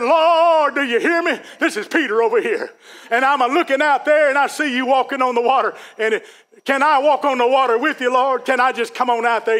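high, loud, eager call to the Lord; Peter calling out. Retold or imitated. Peter calling to Jesus on the water, high and loud. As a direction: call across the water, begging to come. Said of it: "but he said".

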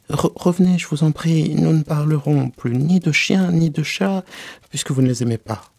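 high, loud beseeching promise; calling back, pleading. Acted. Alice begs the swimming mouse to return, pleading loudly. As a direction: call after someone who is leaving.

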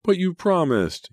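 put-on high, drawn-out little-boy whine; loud, plaintive bedtime protest; whining child. A narrator's reading. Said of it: "he whined".

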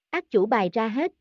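plain reading voice

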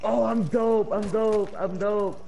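loud, high triumphant shout; excited shouting. Real, not acted. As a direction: yell it in triumph. Boasting after zip line jump.

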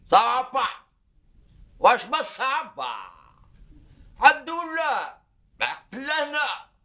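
loud and high, sarcastic fury; husband losing his temper at his wife; exasperated outburst. Acted. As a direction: throw the words back at her in anger. Husband flares up in quarrel.

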